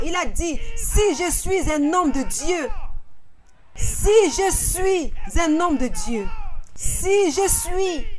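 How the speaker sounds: rising, ringing sermon cadence; fervent preacher building to a cry; preaching at full force. Real, not acted. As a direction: drive the point home to the church.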